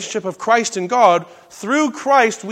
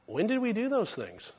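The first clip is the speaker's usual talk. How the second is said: much softer, wondering question; humble puzzlement; 12 dB quieter than usual. Acted. The sheep answer the Lord, quietly puzzled.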